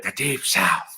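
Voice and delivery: lightly voiced film-character quote; a hint of a character voice on the catchphrase; Little Nicky. Quoting a movie line to friends, light character impression. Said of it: "as Little Nicky put it".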